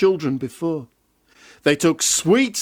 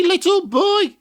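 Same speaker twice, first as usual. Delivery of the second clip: high witch call again, falling; 14 semitones higher than usual. Acted. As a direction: croon it like a wheedling witch. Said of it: "every day she said".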